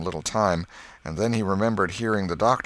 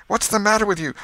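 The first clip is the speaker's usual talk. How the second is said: high, loud question; agitated aunt demanding an answer; urgent demand; 7 dB louder, faster than usual. Acted. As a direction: cut in, alarmed and insistent.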